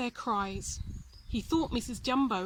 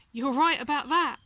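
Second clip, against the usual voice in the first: laughing crows; 3 semitones higher, 7 dB louder than usual. A narrator's reading. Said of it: "laughed the crows".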